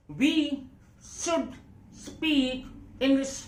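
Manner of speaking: slow, word-by-word English. Shown as a demonstration. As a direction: spell the sentence out slowly for the class. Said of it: "slow में बोल रहा हूं ना". (धीरे-धीरे, एक-एक शब्द)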